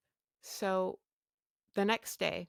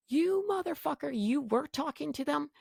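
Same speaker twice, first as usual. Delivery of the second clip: much higher, outraged disbelief; furious at husband; 9 semitones higher, 3 dB quieter than usual. Retold or imitated. Wife yelling at husband who sided with the clinic, outraged and high. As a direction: spit it out at a betrayer.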